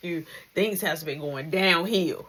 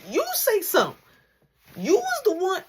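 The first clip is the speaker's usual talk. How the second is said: indignant; 10 semitones higher than usual. Retold or imitated. Retold argument with brother.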